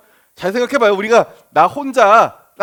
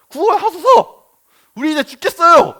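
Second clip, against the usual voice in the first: much higher, louder, huffy cry; sulky demand; 8 semitones higher, 5 dB louder than usual. Retold or imitated. Scene: disciples to sleeping Jesus, sulky and loud. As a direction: demand it like a sulking child. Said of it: "약간 삐진 게 느껴지죠?".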